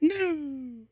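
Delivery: high breathy-looking cry of no, hammed up; mock sexy scream. Reading bad fanfic aloud, a hammed-up high scream. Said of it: "I screamed sexily".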